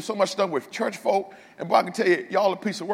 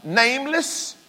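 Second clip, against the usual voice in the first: ordinary talk, nothing marked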